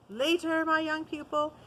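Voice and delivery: stern, high and loud rebuke; severe. A narrator's reading. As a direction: cut him off sternly. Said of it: "said the master severely".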